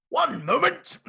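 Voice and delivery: high, loud heroic call; loud yell. Acted. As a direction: shout it out to stall them. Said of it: "that statement definitely carried some volume".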